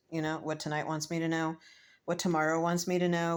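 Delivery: usual speaking voice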